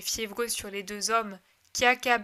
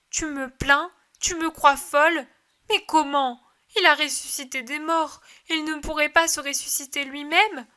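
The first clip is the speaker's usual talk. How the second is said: fervent pleading; 4 semitones higher, 6 dB louder than usual. Acted. Mary insisting to disciples.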